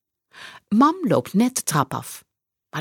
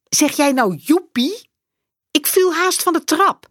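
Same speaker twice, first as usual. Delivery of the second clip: best wel boos; 10 semitones higher, 4 dB louder than usual. A narrator's reading. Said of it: "zegt mam best wel boos".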